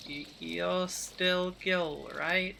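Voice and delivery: slow, softened, unsure question; nervous mutter. Acted. Wary woman checking the giant snake is Gil, muttering slowly. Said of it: "she just mutters".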